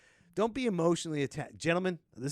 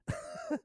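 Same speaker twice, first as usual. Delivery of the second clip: high burst of laughter; 7 dB quieter than usual. Real, not acted. Laughs at own joke.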